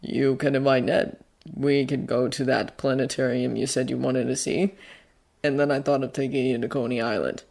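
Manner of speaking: low, soft character voice; Bucky, kind guardian. Acted. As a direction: lay out the treat quietly and evenly.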